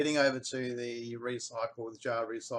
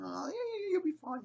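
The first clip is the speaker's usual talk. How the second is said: reassuring. Retold or imitated. Reassures nervous wife.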